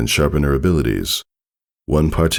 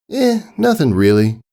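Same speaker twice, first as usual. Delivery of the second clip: nonchalant; 10 semitones higher than usual. Acted. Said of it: "Passing off an air of nonchalance".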